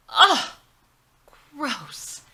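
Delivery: loud high ugh of disgust. Acted. Sister recoiling at the wedding dinner, loud disgust. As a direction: recoil at the plate.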